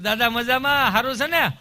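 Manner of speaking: high, booming call to grandpa; over-loud hospital visitor; loud hearty greeting. Acted. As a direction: holler a check-in, climbing in pitch. Greeting a masked hospital patient loudly.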